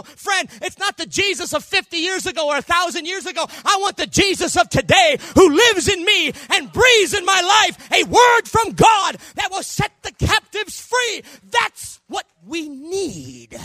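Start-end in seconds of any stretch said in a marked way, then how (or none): 1.7-13.6 loud, high sung-out preaching; preaching at full force. Real, not acted. Preacher shouting to the congregation in full force. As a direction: shout the promise over the music.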